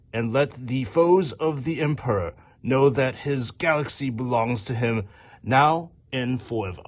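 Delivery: grand, proclaiming read with swooping high pitch; text reading voice. Acted. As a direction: intone it like a solemn warrior creed, drawing out the line endings. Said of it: "get my text reading voice".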